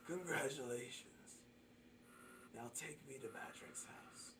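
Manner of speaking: soft, sinking into a whisper; very quiet in-character murmur, fading away; hushed Kenku voice. Acted. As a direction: whisper it as the captive creature. Game master voices the Kenku.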